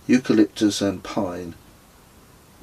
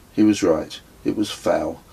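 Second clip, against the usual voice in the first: usual speaking voice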